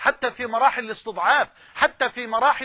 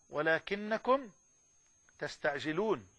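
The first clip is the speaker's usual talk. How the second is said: hushed, slow; 6 semitones lower, 9 dB quieter, slower than usual. Shown as a demonstration. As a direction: drop to a low, soft, drawn-out hush. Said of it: "هي دي".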